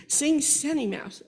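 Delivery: put-on loud drawn-out toddler request. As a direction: call it out slowly like an eager toddler. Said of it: "Oh, that is a difference".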